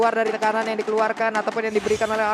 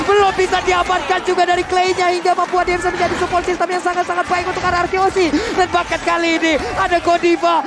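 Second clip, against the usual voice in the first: fighting game announcer; 6 semitones higher, 3 dB louder than usual. Real, not acted. Caster hyping a teamfight.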